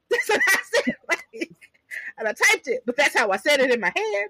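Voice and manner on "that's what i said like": high laughing talk. Real, not acted. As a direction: laugh through the words. Laughing at own reaction.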